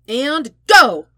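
loud called start; calling go. Acted. A partygoer starts the Flip Cup round with a loud call.

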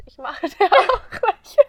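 laughing. Real, not acted. Laughing admission.

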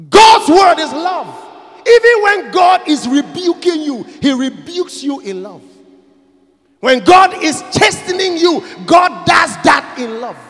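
loud, shouted preaching; full-voiced sermon climax; preaching at full force. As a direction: thunder it out to the congregation.

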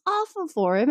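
normal talking voice

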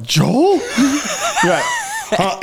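very high, laughing shout; laughing alarm. Retold or imitated. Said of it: "I was like".

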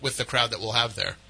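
normal talking voice

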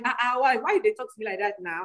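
put-on light Nigerian accent, dropping low. Teasing her mom in a light Nigerian auntie accent. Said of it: "speak like my aunties and uncles".

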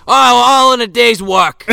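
loud, high mock Australian boast; Steve Irwin. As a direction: crow it like an Australian animal wrangler. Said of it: "I could honestly see fucking Irwin".

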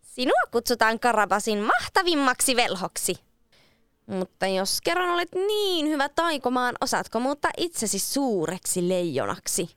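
high, daring challenge to the wizard; bold cat. A narrator's reading. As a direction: brave little cat taunting the wizard.

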